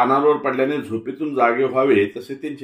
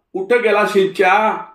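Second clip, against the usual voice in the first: much higher and louder, a yelled कुठं गेला शिंच्या; old man's shout; 10 semitones higher, 5 dB louder than usual. Acted. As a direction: call कुठं out across the courtyard. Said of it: "असे ओरडत".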